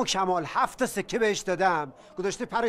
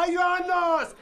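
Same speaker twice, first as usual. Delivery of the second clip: calling out to the crowd; 9 semitones higher than usual. Acted. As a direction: shout so the whole bazaar hears. Vendor appeals to the bazaar crowd.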